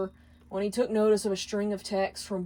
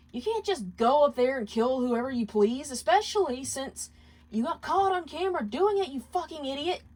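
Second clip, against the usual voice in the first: angry; 6 semitones higher than usual. Acted. Angry quarrel at work.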